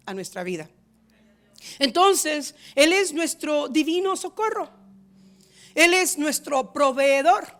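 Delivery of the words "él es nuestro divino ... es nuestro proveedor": ordinary delivery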